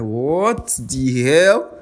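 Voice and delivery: slow, drawn-out disbelief; baffled. Retold or imitated. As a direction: stretch it out in mock confusion. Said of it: "I'm like".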